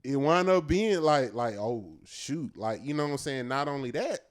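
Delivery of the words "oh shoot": ordinary talk, nothing marked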